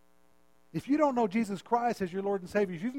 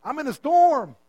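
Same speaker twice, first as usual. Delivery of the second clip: loud, pleading prayer, voice raised high; crying out to God; 4 semitones higher, 4 dB louder than usual. Acted. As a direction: call out to the Lord as if caught in a storm. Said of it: "Would you cry out".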